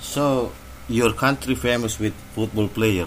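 ordinary talk

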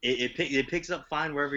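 annoyed bro, cut it out; exasperated. Real, not acted. Friend fussing with his mic.

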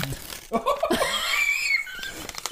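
high-pitched burst of laughter; helpless high cackle; shrieking laugh. Real, not acted. Bursts out at the bad accent.

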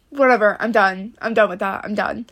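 ordinary talk

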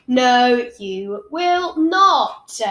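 loud, very slow insistence; firm no. Acted. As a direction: lay down the law word by word. Sister flatly contradicting brother.

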